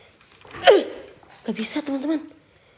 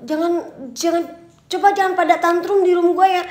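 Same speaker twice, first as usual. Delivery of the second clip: loud, raised, drawn-out scolding; exasperated telling-off; 6 semitones higher, 6 dB louder than usual. Real, not acted. Streamer telling haters to skip the content, drawn-out scolding. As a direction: stretch the words out in a loud lecture. Tells off hostile viewers.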